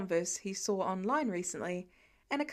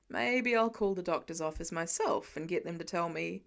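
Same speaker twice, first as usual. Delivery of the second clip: low, half-joking plot; Tony voiced; 5 semitones lower than usual. A narrator's reading. Tony plotting to call the doctor, low and scheming.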